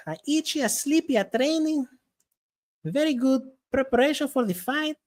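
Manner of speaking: put-on high Brazilian-accented interview voice; Amanda Nunes. Champion talking about training camp, high Brazilian accent. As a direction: answer like a Brazilian champion in broken English. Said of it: "And then you got Amanda Nunes".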